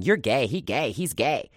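high sing-song schoolyard taunt; sneering kids chanting at a little boy; mock taunting. Fourth grade bullies taunting a second grader, mocking sing-song.

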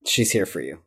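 ordinary delivery

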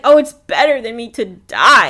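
loud, dramatic whining; mock tantrum. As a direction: throw a childish tantrum out loud. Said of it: "so angry".

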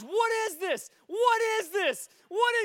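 high, loud, acted alarm; astonished outburst. Swearing man as teen prays for him, loud astonished cries. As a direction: cry out as a man startled by his own body.

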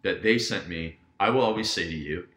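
ordinary delivery

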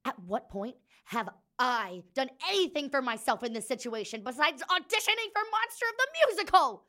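high, loud, rising outrage; furious shout. Acted. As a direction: yell it in disbelief. Griana shouts back.

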